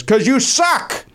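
high, drawn-out mock heckle; imitated heckler. Audience member interrupting the band on stage, imitated heckle. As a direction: heckle slowly, pitched well up. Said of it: "then some guy goes".

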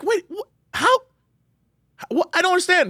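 high, incredulous wait-what voice; baffled disbelief. Retold or imitated. To the co-host, mock-baffled at a fan favourite. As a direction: squeak out your confusion at the twist. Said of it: "It's like".

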